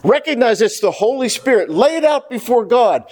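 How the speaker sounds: ordinary delivery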